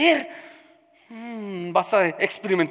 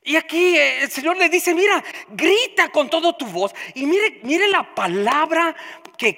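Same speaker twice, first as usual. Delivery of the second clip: preaching at full force; 5 semitones higher, 5 dB louder than usual. Acted. Pastor exhorting the congregation, preaching at full force. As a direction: pound out each phrase like a revival preacher.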